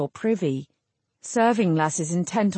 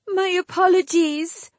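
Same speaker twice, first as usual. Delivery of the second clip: high, wailing apology; 12 semitones higher, 4 dB louder than usual. A narrator's reading. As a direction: wail it out tearfully. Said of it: "Cluna broke in with a wail".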